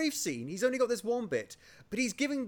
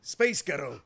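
lower, put-on Scottish accent; 4 semitones lower, slower than usual. Shown as a demonstration. Host demonstrates the phrase trick, Scottish accent. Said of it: "I will demonstrate for you now".

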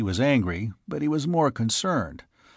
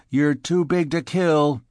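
bitter, raised voice; higher-pitched bitterness; 5 semitones higher, 4 dB louder than usual. A narrator's reading. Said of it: "he said bitterly".

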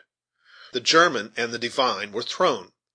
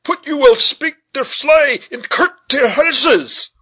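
put-on high, loud, drawn-out German-accented protest; excitable German veteran crying a warning; agitated German accent; 8 dB louder, slower than usual. A narrator's reading. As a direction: shout in alarm with a thick German accent. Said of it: "with unusual emotion".

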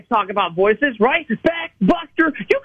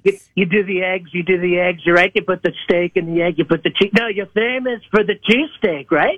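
lower, boastful Trump voice; Donald Trump impression; 3 semitones lower than usual. A caller playing Donald Trump for the hosts, boastful and lower. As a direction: brag like Trump on a rally stage. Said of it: "It's Donald Trump".

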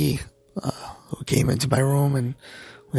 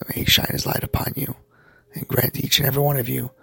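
breathy whispered blessing; weak after surgery. Real, not acted. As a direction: gently bless them with a weak voice. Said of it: "I hope you can understand my voice".

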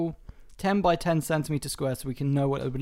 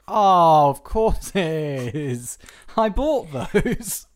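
sudden realisation; 6 dB louder, much slower than usual. Retold or imitated.

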